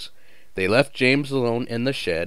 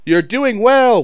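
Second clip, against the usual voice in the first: very high, loud whistle-voice; whistled encouragement; 14 semitones higher, 10 dB louder than usual. A narrator's reading. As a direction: sing it like a whistle. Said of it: "whistled Edward".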